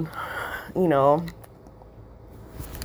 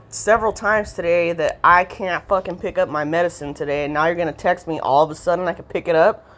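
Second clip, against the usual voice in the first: ordinary talk, nothing marked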